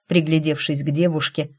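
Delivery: ordinary delivery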